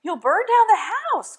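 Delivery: high, loud alarmed cry; mother crying out at the burning roof. Acted. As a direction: shout the warning. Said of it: "cried his mother".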